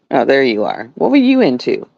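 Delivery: ordinary talk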